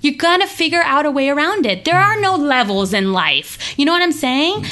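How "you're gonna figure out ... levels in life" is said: emphatic reply. Retold or imitated. Telling students her class has no level, fired up. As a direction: push back with fired-up conviction.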